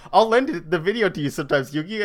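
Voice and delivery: mock Joey voice. Friend offering a video to Yugi, hammed-up and fast. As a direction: rattle it off like an eager pal.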